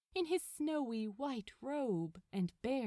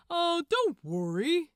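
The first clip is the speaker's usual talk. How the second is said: higher, louder mock comfort; sly reassurance; 7 semitones higher, 9 dB louder than usual. Gabriel teases Crowley about Hastur, mock reassuring. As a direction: pretend to soothe him. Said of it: "said Gabriel".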